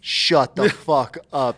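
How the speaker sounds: real incredulous outburst, slow and emphatic; stunned disbelief. Real, not acted. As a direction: stretch each word out in amazement. Stunned by the dog's name.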